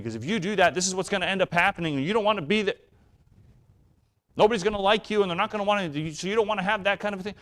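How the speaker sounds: put-on high, fast, worked-up lecture to a child; mock upset parent. Parent upset, lecturing a child about consequences, high and fast. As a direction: rattle it off like a flustered, anxious parent. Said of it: "just as mad".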